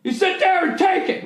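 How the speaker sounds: high mock-shouted family scolding; mock parent yelling. Comic imitating a shouting parent telling him to shut up, high and mock-angry. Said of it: "one of those families like".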